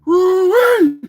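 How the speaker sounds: high Southern woo-wee; Southern whoop. Acted. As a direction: holler it Southern style. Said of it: "Put on my best Southern accent".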